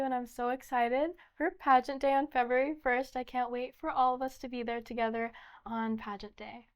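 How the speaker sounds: ordinary delivery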